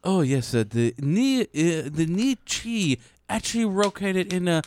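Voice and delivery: lightly put-on Japanese accent; mock Japanese accent. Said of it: "the Japanese accent".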